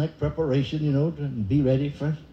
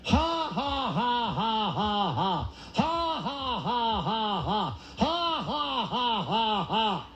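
forced fake laugh; 5 semitones higher, 5 dB quieter, much slower than usual. Shown as a demonstration. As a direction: put on a slow, evenly spaced fake laugh. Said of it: "putting it on".

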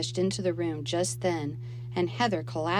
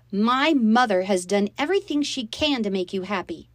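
higher, loud indignant retort; angry shout; 5 semitones higher, 7 dB louder than usual. A narrator's reading. Girl defending her mother to stepsister, shouting. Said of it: "I shouted".

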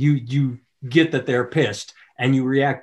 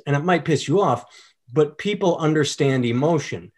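ordinary talk, nothing marked

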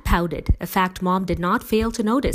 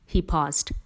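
usual speaking voice

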